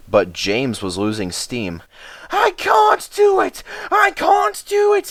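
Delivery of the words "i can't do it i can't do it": very high desperate shout; panted. Acted. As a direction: engine at the end of its strength. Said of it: "he panted".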